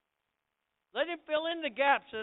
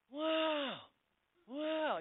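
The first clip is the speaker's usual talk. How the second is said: high, drawn-out wows; awestruck; 6 semitones higher than usual. Retold or imitated. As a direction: imitate someone lost in wonder. Acts out new convert's awe.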